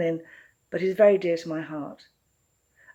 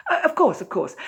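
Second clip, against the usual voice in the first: surprised; 9 semitones higher, 6 dB louder than usual. Acted. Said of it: "swallowed a gasp of surprise".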